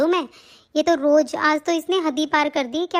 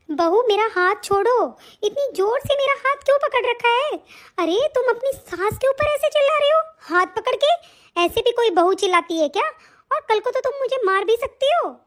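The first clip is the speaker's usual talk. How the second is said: pleading mother-in-law; 5 semitones higher than usual. Acted. Mother-in-law pleading, arm held.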